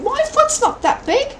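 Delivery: mock your own disbelief, way up high; imitating a doubtful self. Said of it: "I think".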